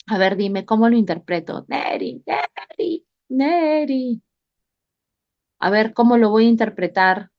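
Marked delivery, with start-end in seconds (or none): 1.7-4.2 repeated name call, ending higher. Real, not acted. Calling a student who does not answer.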